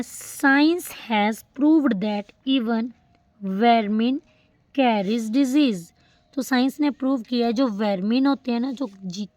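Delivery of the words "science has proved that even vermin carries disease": each word spaced out; very slow example reading; slow dictation. Shown as a demonstration. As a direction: dictate the sentence slowly. Teacher reads example sentence aloud.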